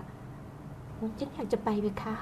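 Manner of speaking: put-on tiny, soft, drawn-out little-girl voice; small child's voice. Shown as a demonstration. Demonstrating young Phloi answering her elder, small child's voice. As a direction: shrink the voice small and slow. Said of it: "คือทำเสียงเล็กๆ เสียงน้อย".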